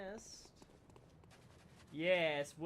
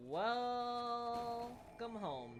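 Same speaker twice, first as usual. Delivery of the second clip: drawn out; 4 semitones higher, much slower than usual. Acted. Greeting chat on return.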